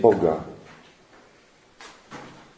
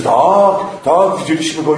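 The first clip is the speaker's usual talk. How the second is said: higher, eager agreement; crowd chiming in; 4 semitones higher, 4 dB louder than usual. Acted. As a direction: join the accusation. (tłum potakuje oskarżycielowi, głośno)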